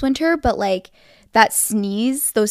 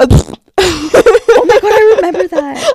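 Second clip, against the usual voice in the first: higher and louder through laughter; laughing; 8 semitones higher, 9 dB louder, slower than usual. Real, not acted. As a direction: burst out laughing while remembering. Recalling sister's silly sneeze.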